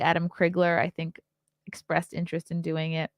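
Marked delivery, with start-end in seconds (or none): none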